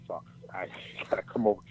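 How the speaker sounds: quick, breathless whisper with a laugh in it; hushed whisper. Retold or imitated. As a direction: whisper it fast under your breath in dismay. Retold shocked reaction to call.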